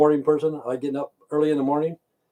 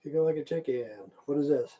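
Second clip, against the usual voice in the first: ordinary talk, nothing marked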